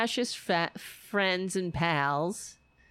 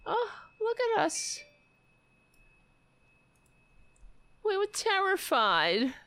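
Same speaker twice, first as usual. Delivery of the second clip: mock scared voice; 9 semitones higher than usual. Mocking the politician, high sarcastic fake fear. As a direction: sneer at her fear in a high voice.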